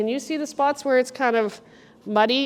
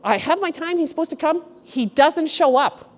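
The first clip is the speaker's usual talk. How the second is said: ordinary talk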